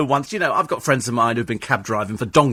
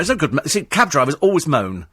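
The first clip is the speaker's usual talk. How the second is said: ordinary talk, nothing marked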